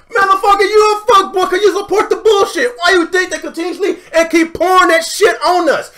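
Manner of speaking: real angry yelling; angry shout. Real, not acted. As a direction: yell it at the commenters. Shouting back at commenters.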